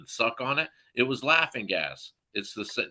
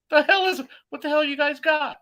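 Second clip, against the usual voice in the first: much higher and louder, incredulous; startled demand; 4 dB louder than usual. Retold or imitated. Younger brother to the guys carrying a tank, high startled yelp. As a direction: yelp it as they haul the cylinder. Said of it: "I'm like".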